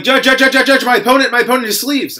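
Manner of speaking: high, loud, rapid-fire mock panic; frantic opponent. Opponent calling the judge over sleeves, frantic mock shout. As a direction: imitate a tattletale urgently calling the judge. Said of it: "my opponent raised his hand and was like".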